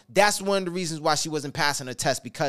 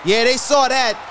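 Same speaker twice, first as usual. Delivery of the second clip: higher, louder rallying call; hype build; 7 semitones higher, 6 dB louder than usual. Acted. Announcer firing up the challenger, rising hype. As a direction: pump up the crowd. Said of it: "crowd hype peak".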